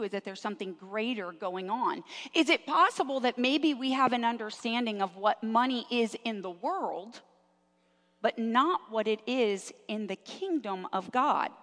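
normal talking voice

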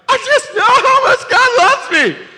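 very high, loud, slow, laughing through the words; giddy joy spilling over as laughter. Pretend. Imagined churchgoer overcome by God's love, laughing high and loud. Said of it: "And you're like".

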